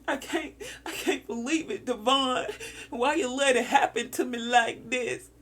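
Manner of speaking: half-laughing mock wail; imitating crying. Imitating a listener crying to Devon, mock tearful. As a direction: play someone's tearful disbelief for laughs. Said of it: "I just heard crying".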